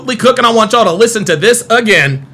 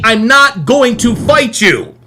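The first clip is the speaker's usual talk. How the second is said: slow quote; slower than usual. Retold or imitated. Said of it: "when he told Carmelo Anthony".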